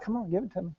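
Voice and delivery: soft, wheedling plea; begging for praise. Acted. Husband to wife, softly begging for a compliment. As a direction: coax a compliment out of her.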